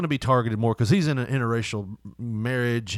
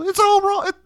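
high, louder pleading tone; mock plea; 5 dB louder than usual. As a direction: plead it in a squeaky voice. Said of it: "I wanted to, like, go".

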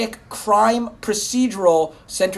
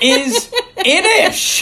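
laughing silly voice; 9 semitones higher, much slower than usual. Pretend.

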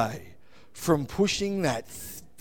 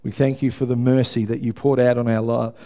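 lower, steady prayer voice; 4 semitones lower than usual. Real, not acted. Pastor opening the service in prayer, humble and steady. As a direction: pray humbly over the congregation.

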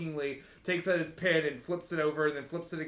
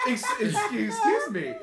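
indignant; 5 dB louder than usual. Acted. GM's character reacting offended.

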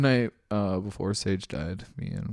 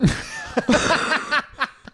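loud, high cackle of delight; high delighted laugh; 6 dB louder than usual. Real, not acted. After the magic wand reveal, laughing loudly. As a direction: crack up at the punchline.

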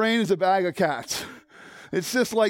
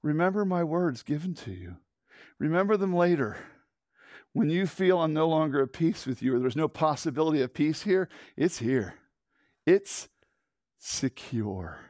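low, soft reassurance, fading away; God's gentle voice; 5 semitones lower, 3 dB quieter than usual. Acted. The Father speaking gently to a troubled heart. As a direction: reassure softly as the Father would. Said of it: "I hear him saying".

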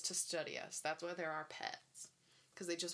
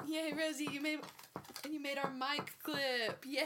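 high, louder cheering praise; talking to a dog; 7 semitones higher, 4 dB louder than usual. Real, not acted.

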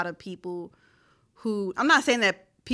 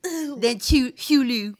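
light mock Baltimore "two, Hulu"; Baltimore accent; 2 semitones higher, much slower than usual. Co-host mocking Baltimore vowels, lightly. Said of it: "I'd much rather hear it's wicked smart".

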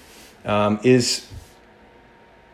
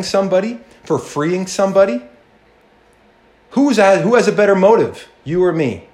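higher and louder toward a challenging question; rising rebuke; 8 semitones higher, 3 dB louder than usual. Acted. Jesus turning the question back on the ruler, rising rebuke. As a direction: build the challenge up and hit it.

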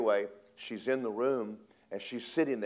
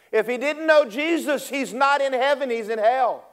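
put-on much higher, loud little boy declaring; a boy shouting his conviction; child's high proclaiming voice; bold child; 13 semitones higher, 10 dB louder than usual. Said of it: "the little boy ... said".

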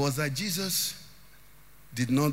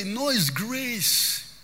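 drawn-out emphasis; 5 semitones higher, much slower than usual. Real, not acted. Preacher stretches key phrase slowly.

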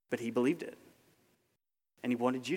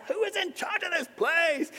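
very high mock outraged demand; hammed-up complaint pitched way up; indignant customer; 4 dB louder than usual. Preacher mimicking a restaurant customer, high mock indignation.

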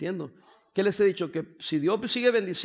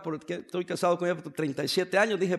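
ordinary delivery

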